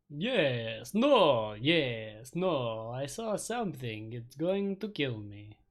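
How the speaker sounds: loud, frantic vampire junkie stammering yes and no; shouted vampire panic, slowing at the end; panicked vampire voice. Acted. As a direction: blurt it out like a terrified vampire. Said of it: "hard to do a vampire voice for very long".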